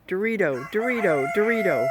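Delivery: rising chirpy bird call; bird call imitation. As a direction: chant it like a bird calling. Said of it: "It literally says".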